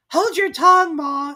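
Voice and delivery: high, loud snappish; snappy. Acted. Young crab snapping at her mother, high and loud. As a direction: snap back. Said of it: "a little snappily".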